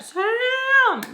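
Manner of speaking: loud, high drawn-out holler of a name; scream. Acted. Frustrated guest calling for Sam, screaming the name. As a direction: yell it out, pitch held way up high. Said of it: "I wanted to scream so bad".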